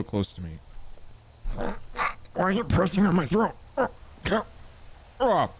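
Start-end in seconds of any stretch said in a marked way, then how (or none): 1.5-5.5 suddenly higher, strained voice; altered high voice. Acted. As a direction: voice jumps up after throat pressed. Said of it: "now I can't stop talking like this".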